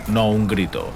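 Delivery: plain reading voice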